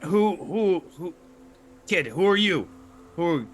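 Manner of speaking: high, loud cranky gnome voice; startled grumpy gnome. Acted. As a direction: squawk at an unexpected visitor, baffled. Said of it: "he looks up and he goes".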